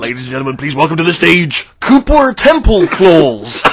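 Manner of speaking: slow announcer voice, name stretched out; arena announcer. Retold or imitated. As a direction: present the act to a huge crowd. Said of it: "It's a simple test".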